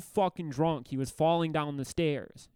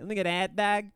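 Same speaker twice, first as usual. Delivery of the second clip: put-on "hot dog" said as "hat dag", New Jersey accent; 4 semitones higher, faster than usual. Friends trading Jersey impressions, New Jersey accent saying "hot dog" as "hat dag". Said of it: "the way they say hot dog".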